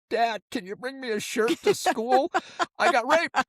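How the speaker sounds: put-on high, whiny kid voice ending in a laugh; boy calling his dad to bring a shirt; pleading kid. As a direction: whimper it like a helpless boy. Imagined call home to Dad.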